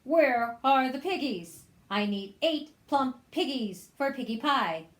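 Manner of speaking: loud, pushy witch demand. Acted. Witch demanding pigs from the cow, loud. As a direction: insist loudly on the piggies.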